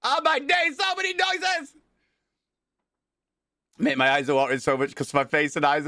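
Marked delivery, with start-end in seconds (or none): 0.1-1.7 very high, overwhelmed shriek; excited squeal. Real, not acted. Streamer swamped by stream alert noises, high overwhelmed shriek.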